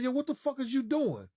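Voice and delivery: high-pitched mock outburst; retold exasperated challenge. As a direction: reenact an indignant, high-voiced protest. Retold challenge to seat recliner.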